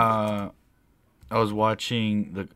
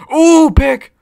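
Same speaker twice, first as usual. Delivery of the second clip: loud, high whoop at the play; much higher and louder, thrilled; real excited outburst; 13 dB louder than usual. Real, not acted. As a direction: cry out at a sudden interception. Live reaction to televised interception.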